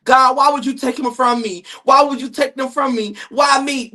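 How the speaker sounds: a hint of a grieving relative begging heaven; mock whining mourner. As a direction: whine it up like a mourner at a funeral. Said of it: "y'all be saying the dumbest shit when somebody die".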